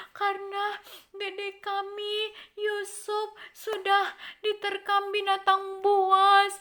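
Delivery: high, loud, hammed-up fake sobbing plea; fake crying. Brothers fake-crying to their father, begging forgiveness. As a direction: pretend to weep to fool papa. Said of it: "Dia pura-pura nangis".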